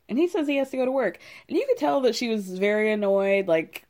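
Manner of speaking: normal talking voice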